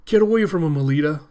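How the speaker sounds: high, loud warning cry; urgent shout. Acted. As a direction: yell to pull her out of danger. Ito warning Alita.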